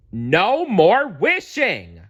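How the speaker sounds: high, loud, slow cry; cried. A narrator's reading. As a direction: spell out every word loudly. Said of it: "cried Wish Bear".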